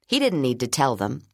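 plain reading voice

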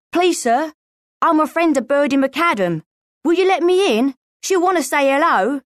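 high-pitched, eager street urchin; pleading boy. A narrator's reading. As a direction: plead to be let inside.

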